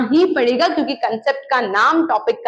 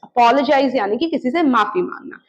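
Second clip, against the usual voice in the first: normal talking voice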